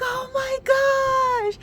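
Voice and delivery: very high-pitched mock squeal; overjoyed squeal. Recalling being hugged really tight, squealing happily. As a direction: re-enact a long, overcome squeal.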